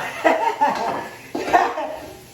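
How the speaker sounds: high laugh. Real, not acted. Laughs at overcooked rice.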